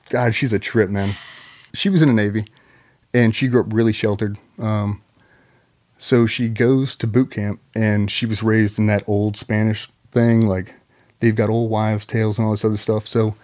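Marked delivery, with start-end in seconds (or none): none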